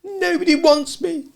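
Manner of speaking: high, close-to-tears whine; a little boy on the verge of tears; nearly crying. Acted. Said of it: "Michael had nearly cried".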